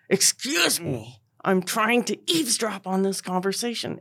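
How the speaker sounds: through gritted teeth. Retold or imitated. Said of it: "he gritted his teeth".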